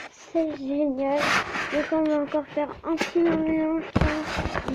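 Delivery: high, loud burst of delight; excited gamer cheering the victory. Real, not acted. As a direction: celebrate a win out loud. Winning the match, delighted.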